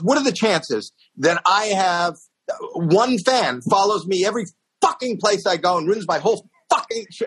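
high-pitched, incredulous venting; exasperated rant. Retold or imitated. Singer complains to comic about his one fan, exasperated rant.

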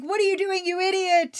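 high exasperated scolding; mock Quatermass. Quatermass to Colonel Breen, high mock scolding. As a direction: scold him like a fool. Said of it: "Quatermass says to him".

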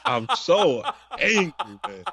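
slow, said through a laugh; half-laughing complaint; laughing mock anger. Real, not acted. Laughing while complaining to his co-host about the show.

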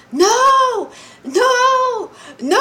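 high, loud screams of No; anguished screaming. Retold or imitated. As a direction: scream it out as if reliving the panic. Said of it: "I started screaming from the top of my lungs".

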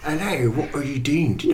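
hammy Cockney chat-up line; overdone Cockney accent. Acted. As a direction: put on a thick silly Cockney.

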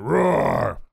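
drawn-out lion roar; big lion. Shown as a demonstration. Coach contrasting lion with kitten, big roar. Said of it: "a big lion".

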